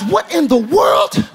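rising, drawn-out disbelief; acted astonishment at yourself; incredulous realization. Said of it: "you realize".

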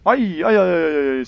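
sudden high yelp of fright; startled shriek. Real, not acted. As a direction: shriek in surprise. Startled by monster appearing.

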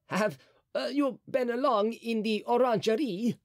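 a touch of fear, pitched high; hesitant, nervous question; a frightened cardinal fishing for what they saw; trembling voice. A narrator's reading. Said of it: "he asked in a trembling voice".

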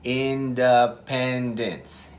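very slow, syllable by syllable Independence; broken into syllables. Shown as a demonstration. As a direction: split Independence into In-de-pen-dence, slowly. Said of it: "rompe en partes".

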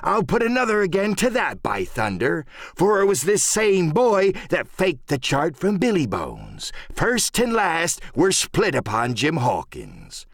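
high-pitched salty sea-dog; emphatic pirate, dragging the last words; pirate cook Silver, accusing. Acted. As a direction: lay the blame with pirate relish. Silver adds his accusation.

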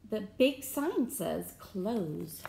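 plain reading voice

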